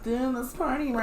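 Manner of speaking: mocking nasally bro. Cohost mocking her nasally voice, high and whiny. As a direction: squeeze it up high to mock her voice. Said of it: "nasally and annoying voice".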